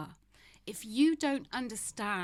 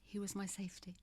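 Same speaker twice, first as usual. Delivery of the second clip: barely voiced whisper; 7 dB quieter than usual. Real, not acted. Widow speaking of her late husband, in a whisper. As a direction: let it fall to a breathless whisper.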